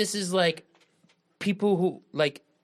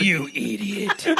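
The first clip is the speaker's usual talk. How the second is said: slow put-on insult; scornful gatekeeper; 2 semitones higher, much slower than usual. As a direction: mimic the gatekeeper's contempt, stretching the words. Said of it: "And he's like".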